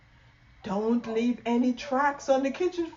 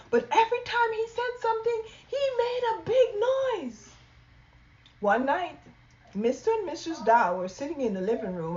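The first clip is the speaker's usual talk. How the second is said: plain reading voice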